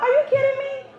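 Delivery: very high-pitched mock outrage; mad disbelief. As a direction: squeal it in furious disbelief. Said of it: "I was so mad".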